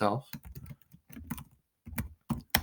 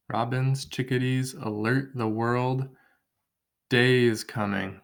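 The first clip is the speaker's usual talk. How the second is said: reading the poem. Shown as a demonstration. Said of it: "one more five-syllable line".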